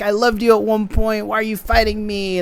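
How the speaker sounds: high, hammed-up wounded plea; mock melodrama. Podcaster predicting the sisters' clash, high mock melodrama.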